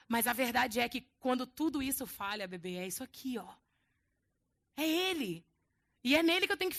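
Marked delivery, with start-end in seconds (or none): 2.5-3.5 much lower and softer, intimate; tender aside. Real, not acted. Preacher tenderly tells the congregation, soft and low. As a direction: lean in and speak gently.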